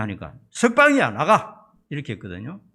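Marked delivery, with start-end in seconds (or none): 0.5-1.7 retold soldier's curt order, higher and louder. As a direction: call out the release like a soldier. Soldier releasing Barabbas in retelling. (군인처럼 높고 크게 외치는 명령조)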